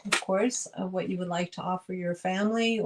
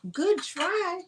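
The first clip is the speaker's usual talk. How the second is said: much higher playful sing-song; 10 semitones higher than usual. Real, not acted. Seller teasing herself over the failed lid fit, high and sing-song.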